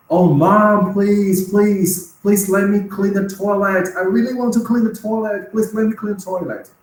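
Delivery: high-pitched, over-the-top pleading; eager begging child. Acted. Child begging mom to let them clean the toilet, high eager plea. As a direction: beg like a kid desperate for a chore.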